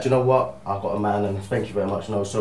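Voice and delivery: low, soft, courteous brush-off; politely retold knock-back, kept quiet; polite refusal. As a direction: imitate someone politely turning down an advance. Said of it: "you'd be very, very polite".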